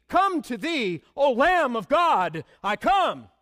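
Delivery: fervent hymn recital. Acted. Hymn lyric preached with fervor.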